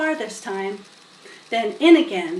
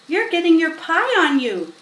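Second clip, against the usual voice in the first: put-on girl voice; 6 semitones higher, 5 dB louder than usual. A narrator's reading. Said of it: "said Fern".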